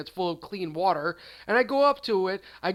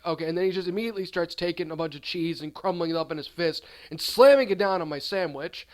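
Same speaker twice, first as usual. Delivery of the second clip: usual speaking voice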